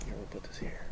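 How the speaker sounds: quiet unvoiced aside; whisper. Real, not acted. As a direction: whisper it off mic. Aside while camera rolling.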